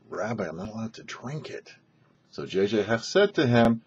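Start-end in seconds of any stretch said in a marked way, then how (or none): 0.1-1.7 much softer, hesitant refusal; quiet protest. Retold or imitated. The sick man refuses the vodka, much softer and hesitant. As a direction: murmur it, almost afraid to refuse.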